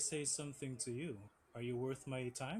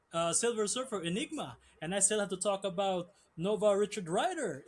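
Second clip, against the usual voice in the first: louder and higher, building a roll call of names; hyped promo shouting; 5 semitones higher, 6 dB louder than usual. Acted. Wrestler hyping the crowd about his rivals, loud and excited. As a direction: bellow each name like a ring announcer.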